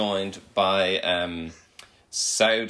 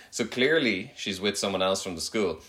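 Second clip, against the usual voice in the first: normal talking voice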